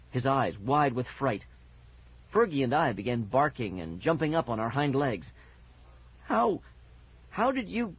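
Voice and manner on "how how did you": high, halting stammer; frightened and stuck for words. A narrator's reading. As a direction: break off, pitched up, as if choking on the question. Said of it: "Mickey stammered".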